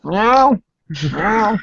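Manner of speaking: high, loud playful meow; cat meow. Pretend. Streamer in cat face filter meows at chat, high loud playful meow. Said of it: "I'll put my cat face on".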